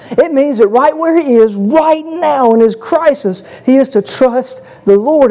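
high-pitched, driving sermon voice; fervent preacher in full flow; impassioned preaching. Real, not acted. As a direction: drive the point home to the congregation. Preaches with rising force.

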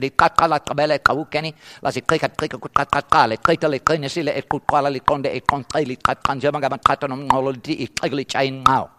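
imitating a rapid-fire click-language speaker; tongue-twisting stream of foreign syllables; rapid foreign recitation. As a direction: rattle off the foreign poem without a break. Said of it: "say that a lot slower".

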